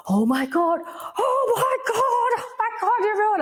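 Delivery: acted sobbing outburst, climbing high and stretching; re-enacted bawling. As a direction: wail it out as if overwhelmed and crying. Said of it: "I'm crying and I'm crying".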